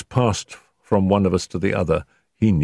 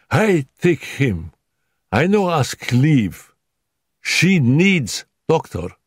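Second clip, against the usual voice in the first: higher, slow, broken-English maid crying out; indignant maid; 9 semitones higher, 3 dB louder, slower than usual. Acted. Dolores scolding her master about the sick wife, indignant.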